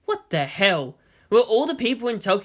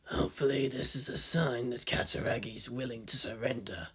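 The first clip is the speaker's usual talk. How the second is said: low, quieter soldier voice; a calm squad leader answering; team leader; 5 semitones lower, 11 dB quieter than usual. A narrator's reading. Team leader answers his soldier, low and quiet. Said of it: "responded the team leader".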